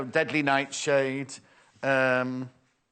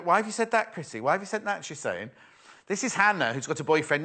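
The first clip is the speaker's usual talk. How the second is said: ordinary talk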